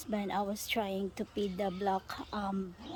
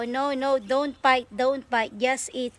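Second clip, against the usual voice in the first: loud scolding; 3 semitones higher, 8 dB louder than usual. Real, not acted. Telling birds not to bite.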